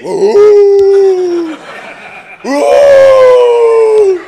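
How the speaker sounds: high, loud wailing mad scientist howl. Acted. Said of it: "je suis un savant fou".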